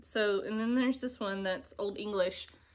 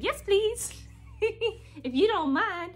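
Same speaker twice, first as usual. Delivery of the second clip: high, loud mock-sweet voice; imitating her friend; 11 semitones higher, 4 dB louder, slower than usual. Retelling friend Jordan accepting floss, high sweet mock voice. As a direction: put on a lightly squeaky polite friend voice. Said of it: "and she said".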